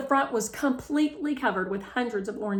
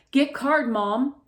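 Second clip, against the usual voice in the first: louder, higher device voice; talker voice; 5 semitones higher, 7 dB louder, slower than usual. Acted. Melody's MediTalker telling Mom, higher and louder. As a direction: speak like a speech device.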